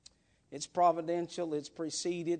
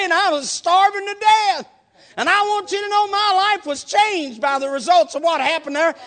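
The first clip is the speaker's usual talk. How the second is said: loud, high, driving preacher voice that keeps climbing; preaching at full force; 12 semitones higher, 16 dB louder than usual. Acted. Preacher telling the congregation about the witnesses, loud and fervent. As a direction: preach the crowd's witness with rising fervour.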